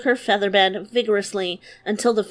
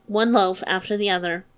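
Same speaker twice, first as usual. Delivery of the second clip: ordinary delivery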